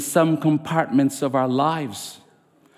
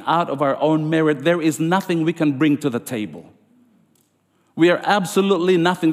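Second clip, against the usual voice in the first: ordinary talk, nothing marked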